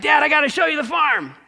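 a son bursting to show off his work; excited son calling out to his dad. Acted. Excited son greets parents.

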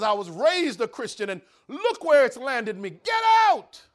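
bitter yell rising to a high scream; angry shout. Acted. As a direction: drive him out with fury. Prisoner rejects preacher, shouting.

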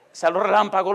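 ordinary delivery

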